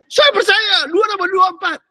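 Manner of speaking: high, loud shouted declaration; hammed-up shout of conviction; mock shouting believer. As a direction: yell it like a zealot proving belief. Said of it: "Dia teriak-teriak gitu".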